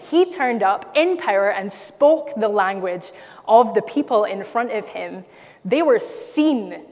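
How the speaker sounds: loud, emphatic proclamation; ringing, declarative sermon delivery; impassioned preaching. Real, not acted. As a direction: drive each phrase home to the congregation. Preaches with rising force.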